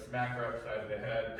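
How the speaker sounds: usual speaking voice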